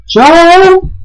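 a sudden high yelled goodbye; loud shout. Real, not acted. Sarcastic farewell yelled in game. (saluto urlato)

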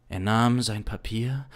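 ordinary delivery

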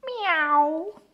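high meow with a rising end; cat meow. Acted. Teacher ends the quiz with a cat's meow. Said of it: "And cat".

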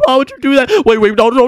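ordinary talk, nothing marked

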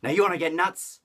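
high, loud shout of the line; hammed-up crazed shout; mock manic yell. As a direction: yell it like smashing a vase, fading to a hiss at the end. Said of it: "and he goes".

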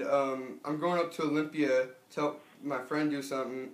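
normal talking voice

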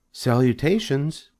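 high, measured greeting; small high-pitched spider hello; thin voice. A narrator's reading. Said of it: "repeated the voice".

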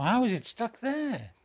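baffled disbelief; surprised. Real, not acted. Grandad puzzled by magnet.

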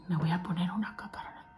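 fast hushed whisper; quick secret whisper. Real, not acted. Whispering while applying.

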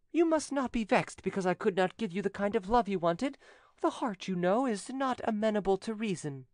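high-pitched, politely superior lady; gracious widow, condescending. A narrator's reading. Widow to the cousin she turned down, graciously condescending. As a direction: thank him with lofty, high-voiced courtesy.

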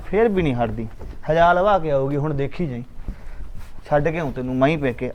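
grumbling to himself. Acted. Husband grumbles after wife leaves.

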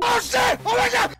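high, loud panicked cry; startled yelp. Acted. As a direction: shout as you fall. Same fall replayed.

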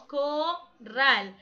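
"corral" split slowly in two, co... rral; slow two-beat demo of "corral"; separated syllables. Shown as a demonstration. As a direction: pause between the syllables of corral. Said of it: "Al separar corral".

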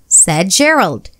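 ordinary delivery, nothing marked

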